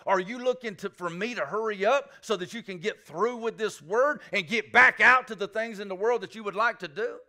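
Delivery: fervent preacher pressing the congregation; preaching at full force. Acted. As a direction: build the questions up into a loud preaching cry.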